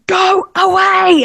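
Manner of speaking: high, slow whispered mock scream; retold scream. Telling the spirit to leave, as a whispered high mock shout. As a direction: hiss it like a half-scream, held back. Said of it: "And I screamed at it".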